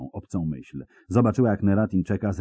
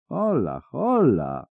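calling out; 7 semitones higher, slower than usual. Acted. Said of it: "zawołał Puszczyk".

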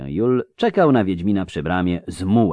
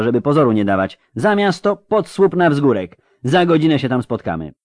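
loud, raised-pitch brisk orders; commanding; 6 semitones higher, 6 dB louder than usual. A narrator's reading. Geralt sending the crew off to pack, firm and urgent. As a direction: take charge and send everyone moving.

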